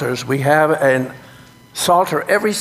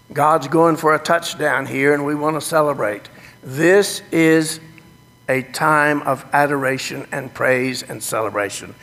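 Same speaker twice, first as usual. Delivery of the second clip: normal talking voice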